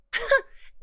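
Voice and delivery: a bright high giggle; high teasing laugh. Acted. Teasing partner about degenerate.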